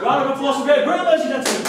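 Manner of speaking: loud, fast host announcement; showman's applause call. Acted. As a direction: whip up the crowd's applause.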